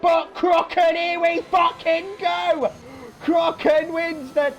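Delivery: high-pitched, stretched winning call; ecstatic play-by-play. Real, not acted. Commentator calling Crocken's win at the line, ecstatic. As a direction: cry out the victory, drawing words out.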